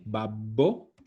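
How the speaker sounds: babbo with a long, held double B. Shown as a demonstration. Teacher warning students about spelling, stressed double B in babbo. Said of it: "ojo la doble B".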